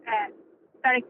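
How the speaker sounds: usual speaking voice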